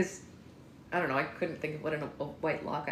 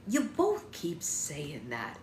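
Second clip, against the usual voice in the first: weary sheriff; much slower than usual. Acted.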